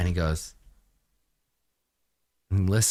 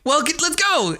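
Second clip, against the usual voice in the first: high hurried guard; 13 semitones higher than usual. Acted. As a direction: rush the other guard along. GM voices other guard.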